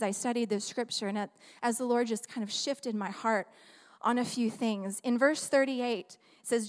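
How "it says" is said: ordinary talk, nothing marked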